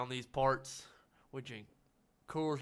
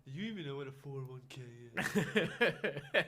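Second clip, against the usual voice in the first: snooty sneer. Retold or imitated. Friend mimics snooty waiter.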